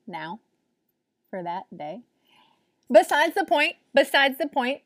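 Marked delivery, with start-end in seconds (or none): 2.9-4.8 dismissive brush-off. Real, not acted. Brushing off her own tangent.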